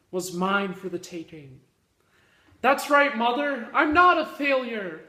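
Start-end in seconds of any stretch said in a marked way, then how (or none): 2.6-5.0 loud, high-pitched mock defiance; defiant outburst. Comic declaring to mother he is no failure, mock defiant. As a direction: proclaim it in hammed-up triumph.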